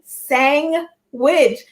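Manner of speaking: SANDWICH split slowly as "sang ... wich"; imitating mispronunciation. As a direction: mimic the error in SANDWICH: a long "sang", then "wich". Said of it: "you're saying sandwich".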